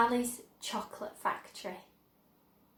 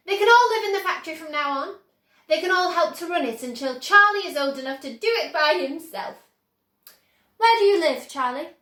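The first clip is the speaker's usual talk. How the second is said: higher, louder, fast excited Wonka; eager rush; 6 semitones higher, 10 dB louder, faster than usual. A narrator's reading. Wonka planning to fetch Charlie's family, excited rush. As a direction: hurry them along breathlessly.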